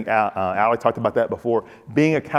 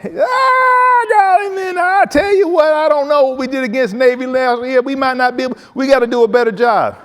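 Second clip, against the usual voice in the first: high, loud, fast-talking coach voice; 6 dB louder than usual. Retold or imitated. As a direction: blast it out like an excited Southern coach. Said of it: "Like I said before".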